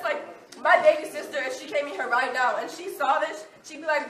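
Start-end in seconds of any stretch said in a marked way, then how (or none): none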